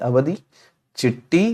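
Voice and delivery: ordinary delivery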